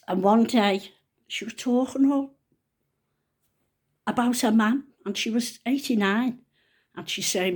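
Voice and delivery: normal talking voice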